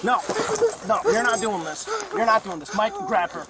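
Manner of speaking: sharp high yell, commanding; shouting. Real, not acted. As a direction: shout the order in alarm. Officer shouting during struggle.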